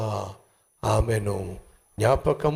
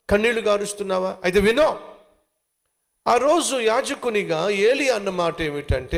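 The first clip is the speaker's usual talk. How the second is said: ordinary talk, nothing marked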